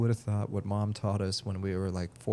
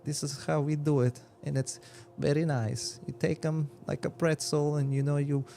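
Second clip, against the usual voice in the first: put-on higher-pitched dad in a thick foreign accent; halting foreign accent, hunting for the English words; 7 semitones higher than usual. Dad teaching his kid jujitsu, thick foreign accent. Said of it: "if we wanna be lore accurate".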